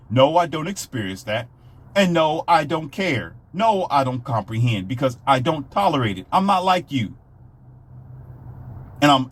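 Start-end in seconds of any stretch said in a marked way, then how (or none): none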